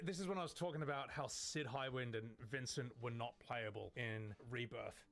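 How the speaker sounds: ordinary talk, nothing marked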